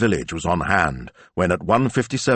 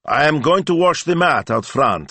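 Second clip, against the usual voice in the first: higher, louder calling voice; 9 semitones higher, 4 dB louder than usual. Acted. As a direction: call across the shop. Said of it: "he called to his assistant".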